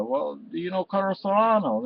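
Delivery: ordinary talk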